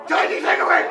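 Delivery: loud, high-pitched hammed-up Cantonese accent; mock Cantonese accent. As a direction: call it out in a pretend Cantonese accent. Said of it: "in your best Cantonese accent".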